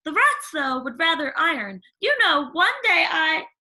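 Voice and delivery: put-on high, bright chatterbox girl; gushing young girl, rising as she goes; eager Cindy telling about animals. Cindy voiced in story.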